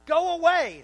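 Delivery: high, loud go away shouted; Jesus ordering the mourners away, loud; loud command. Retold or imitated. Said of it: "Jesus says to the mourners".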